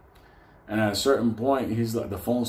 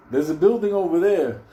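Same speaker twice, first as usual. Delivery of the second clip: raised, higher call across the street; 7 semitones higher, 6 dB louder than usual. Retold or imitated. Benny calling out to the group from the street, raised call. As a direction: shout over to his friend.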